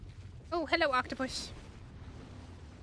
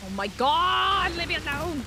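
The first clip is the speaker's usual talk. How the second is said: startled yell; 5 semitones higher, 7 dB louder than usual. Real, not acted. Chased by a sea creature.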